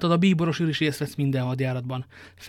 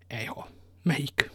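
whisper; 5 dB quieter, slower than usual. Acted. Aramis curious about the horses.

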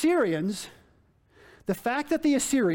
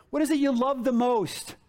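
ordinary delivery

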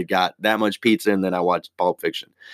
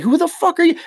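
high, loud outraged shout; indignant yell; 5 dB louder than usual. Retold or imitated. Reacting to a rude Google review, high outraged shout. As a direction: blow up at the reviewer.

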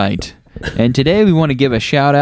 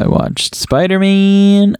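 drawn-out high; 9 semitones higher, slower than usual. Acted. Joking repetition.

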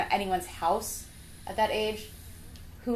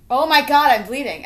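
louder, higher panicked cry; 6 semitones higher, 10 dB louder than usual. Retold or imitated. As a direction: blurt it out in alarm. Retelling first period panic.

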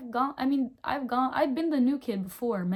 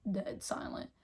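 whisper; 8 dB quieter than usual. Shown as a demonstration. Describing a silent class.

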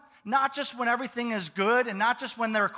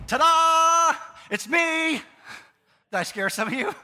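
Jesus; 8 semitones higher, 6 dB louder than usual. Acted. Jesus surprising his dejected followers, loud and triumphant. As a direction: burst out with a big comic surprise. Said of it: "Jesus could have been like".

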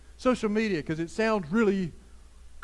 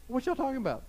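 casual Jesus; 4 semitones higher, 3 dB quieter, faster than usual. Retold or imitated. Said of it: "In other words".

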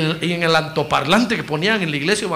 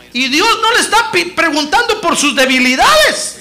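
shouting sermon climax; preaching at full force; 9 semitones higher, 9 dB louder than usual. Acted. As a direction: drive it home to the congregation.

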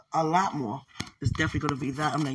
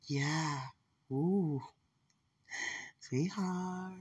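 ordinary delivery

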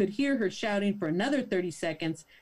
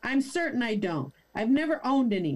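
ordinary talk

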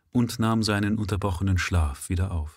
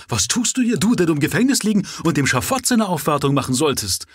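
much higher, loud outraged outburst; indignant shout; 8 semitones higher, 6 dB louder than usual. A narrator's reading. Governor confronts the escaped prisoner at his bedside, indignant shout. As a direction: shout it in a high, rising fury. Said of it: "rief der Gouverneur".

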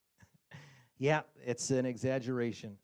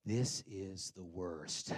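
slow, low, softer moan; low groan to wife; 6 semitones lower, 5 dB quieter, much slower than usual. Retold or imitated. Stuck in drive-thru, complaining to wife in slow low moan. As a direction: sink into defeat. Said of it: "I turned to my wife and I said".

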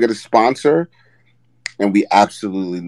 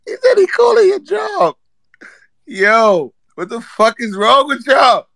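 crying laughter; 14 semitones higher, 5 dB louder than usual. Real, not acted. Host shrieks through tears of laughter.

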